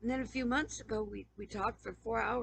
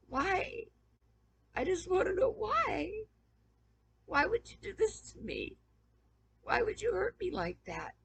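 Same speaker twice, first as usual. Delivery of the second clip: real high-pitched crying outburst; tearful plea; 7 semitones higher than usual. Real, not acted. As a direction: cry it out, pleading for an answer. Tearful confrontation of former friends.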